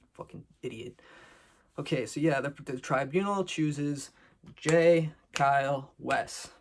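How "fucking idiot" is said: whispered, scornful aside; muttered insult. Real, not acted. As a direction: breathe the insult out under your breath. Insulting Bananas under breath.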